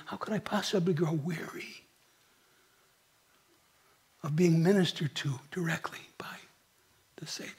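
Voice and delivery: dropping to a whisper; hushed awe. Real, not acted. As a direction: draw them in with a whispered wonder. Awe at intimacy with Christ.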